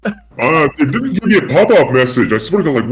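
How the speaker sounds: vocoder-processed voice; voice changer. Real, not acted. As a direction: talk through a voice changer. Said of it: "have you got a vocoder, or is that your actual voice".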